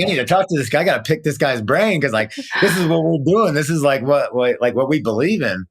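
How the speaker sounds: high, eager retelling; bursting to meet this guy; retold excitement. Retold excited call to mom.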